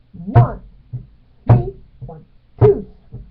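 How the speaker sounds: loud hits on "One", first "three" and "two"; accented hemiola count. Shown as a demonstration. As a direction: count the beats, punching every other one. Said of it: "accent the third beat of that first".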